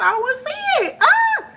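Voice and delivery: squeaky alarmed high yelp; real shock at the card drawn; startled outburst. Real, not acted. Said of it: "I saw something that came out".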